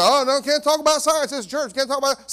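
high, loud mock alarm; exaggerated scandalized protest; imitated objectors. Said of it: "Right, people say".

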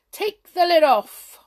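high, loud command; ordering. A narrator's reading. Hop ordering Jump, high loud command. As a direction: call the order out with flair. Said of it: "ordered Hop".

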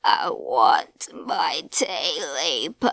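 low, slow, eerie demand; slow unearthly voice wanting its tail; strange creature voice. A narrator's reading. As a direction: drag it out low like something inhuman. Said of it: "he heard a strange voice".

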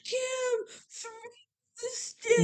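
high, soft mock whine; a hint of a whiny crowd; crowd pleading. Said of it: "Everyone's like".

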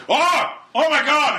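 high startled cry; shocked driver. Retold or imitated. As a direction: yelp at the burning pile. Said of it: "he's like".

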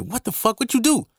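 high, stunned disbelief; shocked brother. Retold or imitated. Brother hearing he got fired, voiced high and stunned. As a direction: jump up in pitch, shocked.

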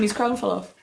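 ordinary talk

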